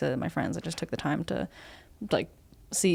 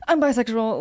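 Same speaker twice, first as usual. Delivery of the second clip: blurted out; 6 semitones higher, 6 dB louder than usual. Retold or imitated. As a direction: blurt it out louder and higher. Acted coming-out blurt.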